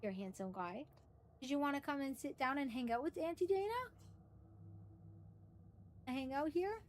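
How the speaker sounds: soft, gentle coaxing; softly inviting, as to a beloved pet; doting pet talk. Real, not acted. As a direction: coax the dog over quietly. Coaxing pet to sit with her.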